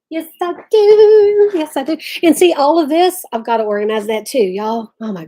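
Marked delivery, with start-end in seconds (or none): 0.1-1.5 high, loud, slow gush; emphatic yes. Real, not acted. Host gushing to viewers about her glass art. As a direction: sing out the yes with delight.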